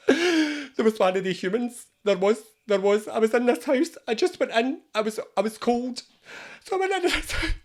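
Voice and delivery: high-pitched, distressed little spider voice; traumatised spider. Acted. Spider at a support group about humans, comically distressed. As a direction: play a shaken victim sharing the ordeal.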